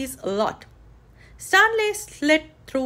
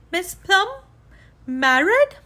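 Married? rising in shock; disbelieving, heart sinking; dismayed surprise; 7 semitones higher, 4 dB louder than usual. A narrator's reading. Stanley's heart sinks.

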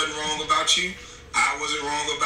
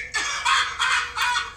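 big laugh. Real, not acted. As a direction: crack up hard. Laughs at the clip.

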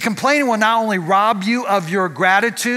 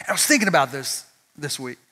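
ordinary delivery

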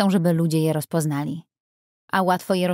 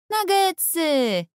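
put-on high, slow delighted squeal of a little girl; girl thrilled to hear about nuggets; exuberant child; 11 semitones higher, much slower than usual. A narrator's reading. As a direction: stretch it out in pure glee. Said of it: "wykrzyknęła Ailey z żywiołowym entuzjazmem".

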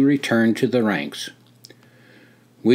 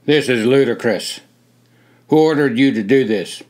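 scolding officer; 5 dB louder than usual. A narrator's reading.

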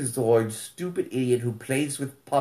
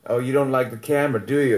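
ordinary delivery

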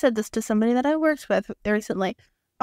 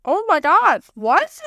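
high, louder exasperated astonishment; mock disbelief; 7 semitones higher, 5 dB louder, slower than usual. Friend reacting to someone who missed the quote, mock disbelief. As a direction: exclaim it high, acting incredulous.

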